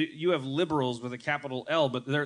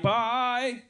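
high mock Australian bye; 8 semitones higher than usual. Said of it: "I'll be John".

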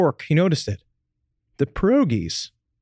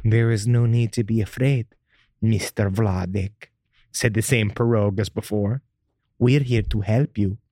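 low, slow, seductive woman; sexy pierogi voice; 6 semitones lower than usual. Acted. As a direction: purr it like a temptress. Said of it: "It was deep and sexy".

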